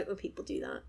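normal talking voice

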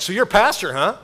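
bright-pitched leading question; a hint of a nosy acquaintance; probing neighbor. Acted neighbor sizing him up.

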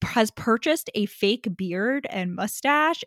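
normal talking voice

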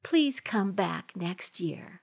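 slow whispered plea. Acted. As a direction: whisper the last line softly. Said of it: "Would you read it for us?".

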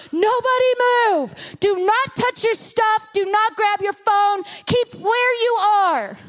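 high, slow shouted-style commands without full volume; mock yelled orders. Officers yelling at everyone in the checkpoint, imitated yelling. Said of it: "they've been yelling out".

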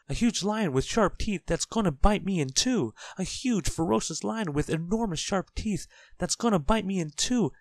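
high, loud, escalating panic; hysterical shriek. A narrator's reading. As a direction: pile up horrors in a wailing high voice. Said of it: "shrieked Mister Jelly".

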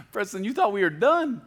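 laughing through the words, high and loud; teasing laugh. Retold or imitated. God teasing Preston, laughing as he speaks. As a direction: tease him with a laugh in it.

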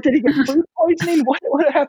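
very high, fretting inner voice; mock worry. Speaker worrying the cookies made him sick, high mock panic.

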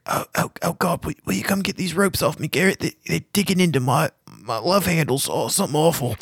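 high, pleading tied-up captive; struggling against restraints. Acted. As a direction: whimper at friends to be freed. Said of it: "He sees you all and says".

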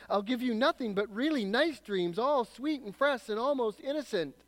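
high, pleading lizard voice that climbs as it begs; chattering lizard. Acted. Lizard bargaining with the ghost to spare it, high wheedling voice. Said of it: "the lizard began chattering".

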